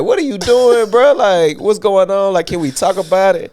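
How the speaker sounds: high, loud appeal to talk; incredulous pleading. Retold or imitated. Pleading with swinging roommate.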